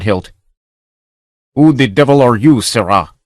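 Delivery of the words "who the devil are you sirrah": louder, hostile captain's challenge; ship's master challenging an intruder, hostile and loud; unfriendly demand. Acted. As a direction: bark at the stranger as ship's master. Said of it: "in no friendly tone".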